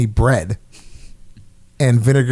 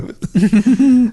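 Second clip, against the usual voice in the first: high amused laugh; 9 semitones higher than usual. Real, not acted. Laughing at own story.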